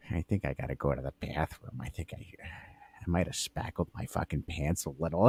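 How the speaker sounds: hushed, low confession; whisper. Retold or imitated. Old coworker admitting he messed his pants, whispered. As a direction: whisper it, embarrassed. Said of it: "He looks at me and goes".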